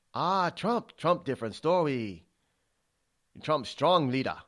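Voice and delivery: hammed-up Chinese accent, blunt and emphatic; a bad Chinese accent, declaring loudly. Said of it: "that's my very bad Chinese accent".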